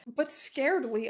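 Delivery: usual speaking voice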